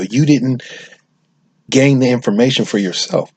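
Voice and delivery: exasperated retelling of telling her off; heated retort. Retold or imitated. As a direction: press her hard for not watching. Retold argument with a friend.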